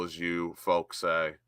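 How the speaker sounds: ordinary talk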